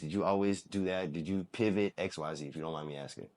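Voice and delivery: usual speaking voice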